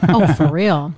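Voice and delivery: usual speaking voice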